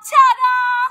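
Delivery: loud high ta-da shout; yelling. Shown as a demonstration. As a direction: yell it out big. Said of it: "jump up and yell".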